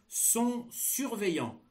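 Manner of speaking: slow, high dictation voice; dictating. Shown as a demonstration. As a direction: dictate slowly and clearly. Dictation of text chunk.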